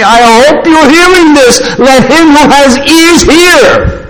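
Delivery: high, ringing pulpit proclamation; emphatic preacher correcting a false picture; full-force preaching. Real, not acted.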